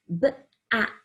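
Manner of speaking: a separate b, then ak with a crisp k; sounded out. Shown as a demonstration. Teacher showing the k sound in back, sounded out. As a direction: BACK split into b and ak. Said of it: "makes that k sound".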